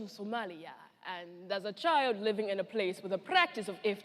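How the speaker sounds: low, subdued storyteller; survivor's testimony. Acted. Survivor recalling her childhood in Somalia, low and reflective. Performs a survivor's first-person account.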